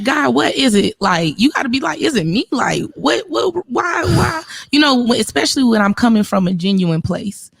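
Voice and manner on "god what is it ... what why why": high pleading cry of why; throwing up hands asking God why; exasperated plea to God. Retold or imitated. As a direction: voice climbing in frustrated disbelief. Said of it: "And you like".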